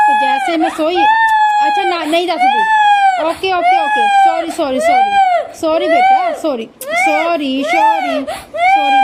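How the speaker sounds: very high, loud repeated apologies over crying. Real, not acted. Mother apologises over crying baby.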